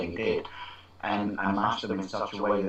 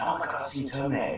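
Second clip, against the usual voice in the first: put-on quick, higher-pitched boyish enthusiasm; retold excitement; 4 semitones higher, faster than usual. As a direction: gush about the film to a mate. Excited schoolboy retelling a film.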